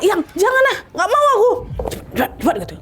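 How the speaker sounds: very high, pleading and resisting; high panicked woman refusing; frightened refusal. Acted. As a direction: beg him to let go, in pain. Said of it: "Ria tolak".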